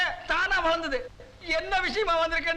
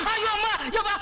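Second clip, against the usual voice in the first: high, panicked cry of ஐயோ; panicked wail; 7 semitones higher than usual. Acted. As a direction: wail in comic despair, higher. Panicked man wails to wife. (பயத்தில் உயர்ந்த குரலில் ஓலம்)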